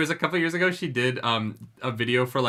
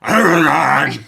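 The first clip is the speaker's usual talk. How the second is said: mock gargling-rocks dragon voice, louder and slow; Saphira voice; 10 dB louder, much slower than usual. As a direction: ham up a dragon that sounds punched in the throat. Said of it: "Can you try and recreate it?".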